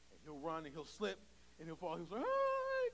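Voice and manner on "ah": high, loud mock wail of a toddler; imitated child's cry. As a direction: cry out like a little boy who fell.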